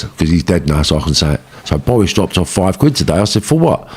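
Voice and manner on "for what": high-pitched, disbelieving question; taken-aback, higher-pitched query; incredulous retort. Retold or imitated. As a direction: retell it as a baffled challenge. Acts out puzzled reply to partner.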